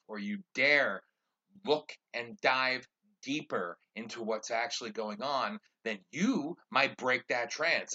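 loud, driving preacher rant; preaching. Real, not acted. Preaching to listeners about the drug culture, loud and fervent. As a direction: hammer the point like a sermon. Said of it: "I'm preaching on this".

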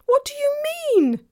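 put-on very high, loud child's cry; alarmed cry. A narrator's reading. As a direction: cry out in frightened disbelief. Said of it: "cried Lucy".

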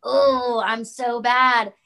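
drawn-out mocking "oh, I'm so bad"; mock contrition. Friend called her condescending, mock contrite reply. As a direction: exaggerate the guilt, stretching it out slowly.

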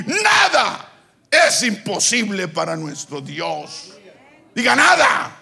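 high, driving, exhorting sermon voice; full-force preaching. Real, not acted. Preacher challenging the congregation to believe, full-force preaching. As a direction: preach it high and hard, building to a shout.

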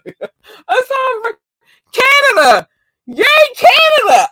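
high, loud happy screams; excited screaming. Real, not acted. Streamer greeting Canadian fans, shrieking with joy. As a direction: shriek it with glee. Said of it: "that's how I'm bringing everybody in".

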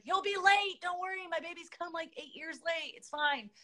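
high breezy mock reassurance; high playful reassurance. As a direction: toss it off high and carefree. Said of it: "I was like".

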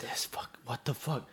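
hushed, voiceless disbelief; stunned whisper. Retold or imitated. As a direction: whisper it to yourself, appalled. Stunned aside watching her load rounds.

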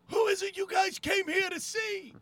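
high, full-voiced MC holler; hype shout. Kid behind the speaker asking who they came to see, hype shout. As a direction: imitate an emcee yelling to rile up a crowd. Nervous kid works up crowd.

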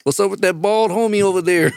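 high Chicano street voice. Acted.

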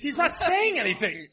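said through real laughter, slowed; laughing while getting the words out. Real, not acted. Laughing at the therapist joke.

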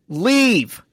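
high-pitched exasperated snap; mock yell. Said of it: "snaps at both of them and yells".

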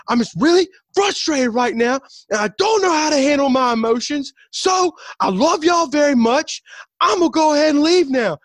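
high, loud comic meltdown; imitated frustrated outburst. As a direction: strain high and loud, announcing your exit. Acts out character's frustrated outburst.

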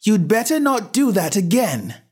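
high sharp call of warning; shouted warning. A narrator's reading. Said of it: "shouted out".

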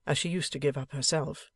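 ordinary delivery, nothing marked

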